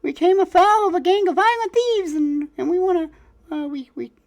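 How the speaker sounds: very high stammering squeak; flustered rat folk. Acted. Fidget confesses about the thieves to the ringmaster, very high stammering squeak. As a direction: stumble through the confession like a panicked little rat.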